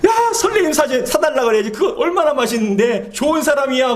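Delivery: high-pitched mock child's enthusiasm; excited child. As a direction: burst in high, like a kid wanting ice cream. Voices delighted child wanting ice cream. (신난 아이 흉내, 높은 목소리)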